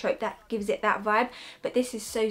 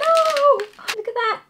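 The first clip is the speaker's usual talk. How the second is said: excited gasp; 13 semitones higher, 6 dB louder, slower than usual. Real, not acted. First sight of the perfume.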